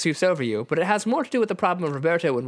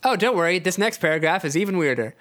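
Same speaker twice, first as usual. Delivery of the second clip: ordinary talk, nothing marked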